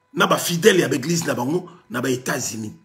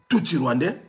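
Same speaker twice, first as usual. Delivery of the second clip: ordinary talk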